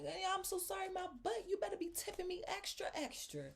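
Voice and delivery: high, loud, scornful mock sorry; sarcastic angry retort. Demanding a tip from the apologizing customer, high scornful retort. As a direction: throw his apology back at him. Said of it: "I'm like".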